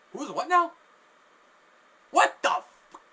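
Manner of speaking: high, loud, baffled outburst; shocked disbelief. Retold or imitated. Stunned onlooker reacting to the homeless man's words, shocked disbelief. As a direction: blurt it in acted bewilderment.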